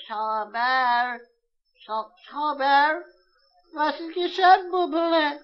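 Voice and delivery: high, slow, doting mother; mother soothing her little son; tender mother and child voices. Acted. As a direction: coax a crying boy gently. Mother and crying child dialogue.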